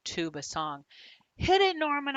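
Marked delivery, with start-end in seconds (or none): 1.4-2.2 high, loud eager call; excited cue. Acted. Boy cueing his goldfish to play, excited call. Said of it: "I say".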